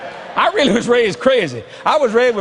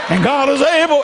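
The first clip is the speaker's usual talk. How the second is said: rising church cadence on able; whooping preacher; 4 semitones higher than usual. Shown as a demonstration. Preacher whooping to the congregation, rising cadence. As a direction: lean into the whoop. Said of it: "It's called hooping".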